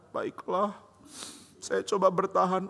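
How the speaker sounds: soft, meek mock sadness; acted wounded little voice; resigned child. Child acting resigned, pitiful.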